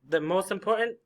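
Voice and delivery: normal talking voice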